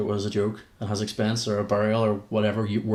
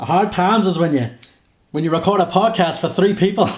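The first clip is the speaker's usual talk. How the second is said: Dusty Rhodes; 7 semitones higher, 7 dB louder than usual. Retold or imitated. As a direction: ham up a loud old wrestling promo. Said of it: "Dusty Rhodes".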